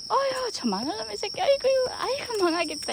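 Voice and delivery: very high affectionate wailing coo; doting coo. Shown as a demonstration. Owner demonstrates an insult said with affection, doting coo. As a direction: gush it with exaggerated love. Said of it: "예를 들어".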